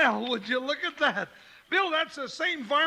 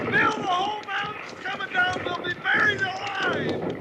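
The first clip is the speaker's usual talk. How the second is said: higher, frightened shout; panicked cry; 7 semitones higher than usual. Acted. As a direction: scream as rocks come down. Panic as mountain collapses.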